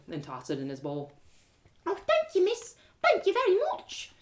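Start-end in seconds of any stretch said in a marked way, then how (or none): 1.9-4.1 put-on much higher little boy voice; a timid child calling out; small boy. Ragged beggar boy calls to narrator, timid small voice.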